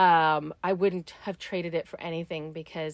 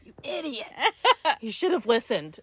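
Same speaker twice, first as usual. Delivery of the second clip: self-scolding; 5 semitones higher than usual. Retold or imitated. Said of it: "And then I'm like".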